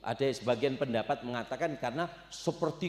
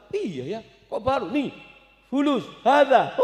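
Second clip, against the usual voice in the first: put-on child's voice; 9 semitones higher, 7 dB louder than usual. Orphan shows off his money.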